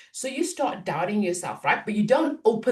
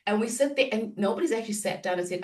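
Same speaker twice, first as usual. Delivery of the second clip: normal talking voice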